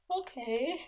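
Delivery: small, high, hesitant okay; soft rising uncertainty after a long pause; awkward okay. Retold or imitated. Confused student answering her classmate, awkwardly. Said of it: "like, very awkward".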